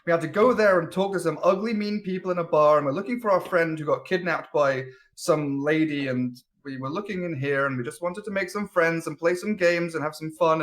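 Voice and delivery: ordinary talk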